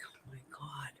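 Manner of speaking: breathless hushed whisper; soft, voiceless gasp of delight; awed whisper. Retold or imitated. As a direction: whisper it, melting at the cat. Overwhelmed by cute sleeping cat.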